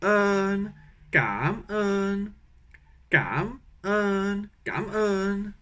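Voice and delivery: un high, cam level; Vietnamese tones. Shown as a demonstration. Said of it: "Donc ça fait".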